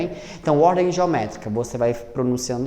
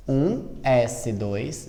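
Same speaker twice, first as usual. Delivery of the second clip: ditando devagar; much slower than usual. Shown as a demonstration. Professora dita a distribuição.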